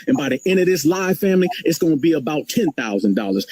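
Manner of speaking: ordinary talk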